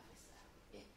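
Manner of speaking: normal talking voice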